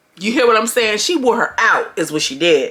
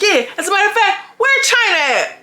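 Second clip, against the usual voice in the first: mock tough Ivory; 11 semitones higher than usual. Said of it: "She's like".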